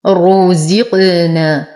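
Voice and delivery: very slow "رزقنا" with heavy ra, full u and bouncing qaf; تفخيم الراء. Shown as a demonstration. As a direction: recite "رزقنا" drawn out, light zay, echoed qaf. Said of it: "نفخم الراء مع إتمام الضم". ("رزقنا" ببطء مع تفخيم الراء وقلقلة القاف)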